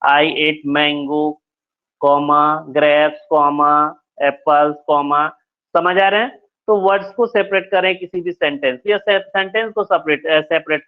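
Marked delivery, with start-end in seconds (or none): 0.1-5.3 slow, saying comma aloud after each fruit; slow dictation with commas. Shown as a demonstration. Teacher dictating an example list to students, slowly. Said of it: "वहां पे भी मैं comma करते जाऊंगा".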